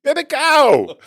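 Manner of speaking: high, very loud mock Filipino shout; mock Filipino accent. Filipino cook explaining what veal is, loud high mock Filipino accent. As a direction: yell it as an exasperated Filipino cook. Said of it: "fun of the Filipino cook".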